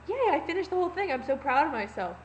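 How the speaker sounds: high, loud yay easing down; mock proud cheer. Telling mom and grandma about finishing a hot dog, proud cheer. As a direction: celebrate a tiny feat with hammed-up pride.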